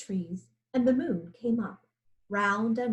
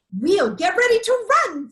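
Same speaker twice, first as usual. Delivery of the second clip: much higher and louder, eager; excited peccaries; 8 semitones higher, 9 dB louder than usual. A narrator's reading. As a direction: squeal it out ready to bolt.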